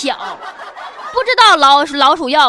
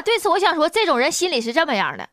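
usual speaking voice

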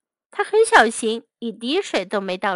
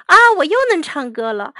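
delighted; 5 semitones higher, 6 dB louder, much faster than usual. A narrator's reading. Lady delighted to sing again.

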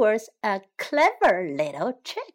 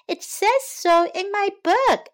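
high-pitched chick voice; little chick; 9 semitones higher, 3 dB louder than usual. A narrator's reading. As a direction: insist as a clever little chick. Pip insists.

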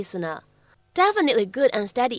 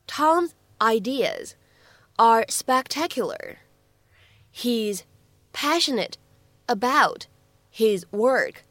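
each chunk of the line read very slowly and clearly; slow careful teacher reading; slow model reading; much slower than usual. Shown as a demonstration. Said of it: "注意哪些发音技巧".

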